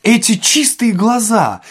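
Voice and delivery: high, drawn-out, emphatic recognition; sudden realisation. A narrator's reading. Administrator finally remembering Ostap's eyes, emphatic. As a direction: ring it out as memory strikes.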